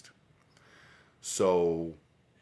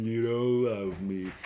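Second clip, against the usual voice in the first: higher, slow, hammed-up whine; whiny mock crying; 6 semitones higher, 6 dB louder, slower than usual. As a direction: mimic a crybaby pouting, drawn out. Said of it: "here's old whiny pants just crying".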